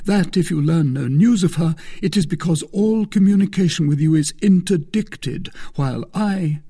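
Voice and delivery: high, loud lament of a forsaken lover; impassioned Aramis. Acted. Aramis voiced in earnest speech.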